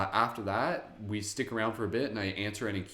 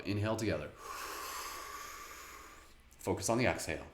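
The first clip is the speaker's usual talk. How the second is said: guided breathing. Acted. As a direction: guide the inhale and exhale gently. Leads breathing.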